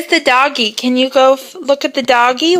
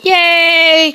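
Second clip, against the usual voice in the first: ordinary talk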